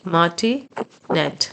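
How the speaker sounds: martinet drawn out syllable by syllable, very slowly; very slow model word. Shown as a demonstration. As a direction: spell out martinet slowly for the class. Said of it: "repeated model word". (martinet को बहुत धीरे-धीरे, एक-एक हिस्सा करके)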